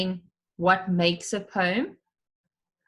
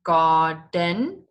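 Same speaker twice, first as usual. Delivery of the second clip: garden split gar-den, louder; syllable demo; 5 dB louder than usual. Shown as a demonstration. Teacher modelling two syllables for class. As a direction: sound out gar-den clearly. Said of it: "and you say the word".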